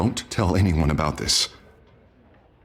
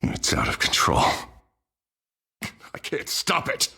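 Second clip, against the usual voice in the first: despairing whisper; 8 semitones higher than usual. Acted. Harvey despairing alone.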